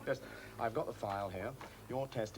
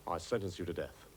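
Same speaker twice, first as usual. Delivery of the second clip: low-pitched trial judge voice; judge's voice; 6 semitones lower than usual. Shown as a demonstration. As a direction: pronounce sentence low, as a stock judge. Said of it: "How's this for starters?".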